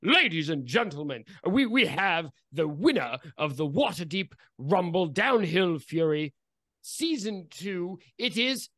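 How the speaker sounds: proud master of ceremonies, stretching the final words; race official announcing. Acted. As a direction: announce the winner to the crowd. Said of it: "and says".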